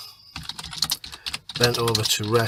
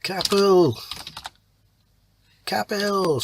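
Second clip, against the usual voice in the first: urgent call raised high, the name shouted twice; shouting his name; 8 semitones higher, much slower than usual. Shown as a demonstration. Teacher showing a messenger shouting the name from the hill. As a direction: cry the name out across the field. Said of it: "So he's really shouting it out".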